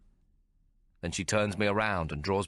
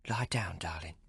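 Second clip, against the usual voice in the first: whispering; 5 dB quieter than usual. A narrator's reading. Intimate invitation.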